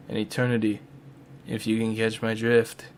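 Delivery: low, soft vampire aside; Dracula. Pretend. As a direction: drop to a hushed vampire innuendo. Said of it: "Dracula will be voiced by Dracula".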